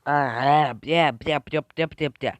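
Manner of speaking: loud, staccato run of yelps; rapid yapping. Acted. Storyteller voicing the pack's reply, loud rapid yapping. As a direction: yap it out in quick bursts. Acting out pack's excited noises.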